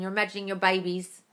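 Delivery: ordinary delivery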